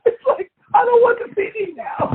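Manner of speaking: high, amused mock complaint; squeaky laughing fan letdown; giggling as a disappointed fan. Real, not acted.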